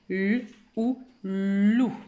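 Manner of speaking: "L", "ou", then "loup", spaced out; sound then blend. Shown as a demonstration. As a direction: sound out "L ou loup", parts first, then joined. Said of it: "on a lu". (« L ou loup » décomposé puis lié)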